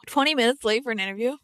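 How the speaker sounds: loud disbelieving question; a startled cry at herself; shocked. Acted. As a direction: inner voice realising she is late, shocked. Shocked inner thought.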